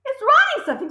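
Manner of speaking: high frantic squeal; excited scream. A narrator's reading. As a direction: shout it in wild alarm. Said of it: "screamed Nigel".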